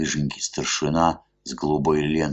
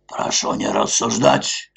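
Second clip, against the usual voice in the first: stern shout; 6 semitones higher than usual. A narrator's reading. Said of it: "крикнул со всей силы".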